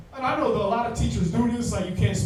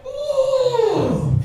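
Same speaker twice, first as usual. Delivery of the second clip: high, loud gloating whoop; mock gleeful cheer; 6 dB louder than usual. The French celebrating as America falls, a loud gloating whoop.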